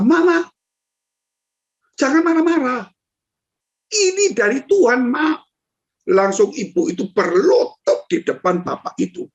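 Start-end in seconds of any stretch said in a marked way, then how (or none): 0.0-5.4 put-on high little child's voice, pleading; a small child gently begging mama to stop. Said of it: "anaknya itu yang kecil, dia berkata".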